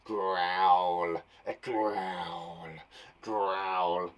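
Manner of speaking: very low, drawn-out growled word; deep, stretched growling. Shown as a demonstration. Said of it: "the way in which we're doing it".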